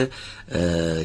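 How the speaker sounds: usual speaking voice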